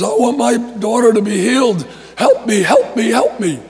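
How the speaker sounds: high, loud cry for help; imitated desperate yelling. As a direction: act out a mother yelling for help. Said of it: "this woman is following Jesus yelling".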